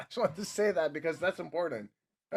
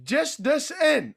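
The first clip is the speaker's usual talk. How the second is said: breaking news announcement; 7 semitones higher, 4 dB louder, much slower than usual. Shown as a demonstration. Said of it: "I fucked that up".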